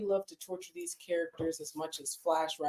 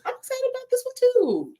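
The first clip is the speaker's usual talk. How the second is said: much higher, louder insisting; high insistent protest; 8 dB louder than usual. Real, not acted. Cohost defends being excited for both books, high and loud. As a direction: squeak it out, defensive. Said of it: "I just don't like the way you said that".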